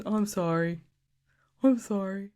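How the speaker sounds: a hint of a sheepish boyfriend apologizing; lightly voiced meek apology; mock sheepish boyfriend. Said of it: "And he just is".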